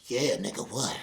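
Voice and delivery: hammed-up bedroom voice; mock breathy moaning. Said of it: "she's like".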